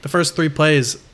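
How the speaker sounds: usual speaking voice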